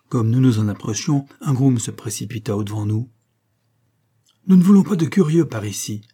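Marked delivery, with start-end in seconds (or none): 4.5-6.0 higher, louder shout to turn visitors away; crying out. A narrator's reading. Groom warns off curious strangers, crying out. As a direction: call it out sharply at intruders. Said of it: "cria-t-il".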